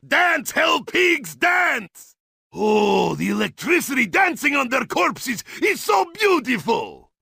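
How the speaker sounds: ordinary delivery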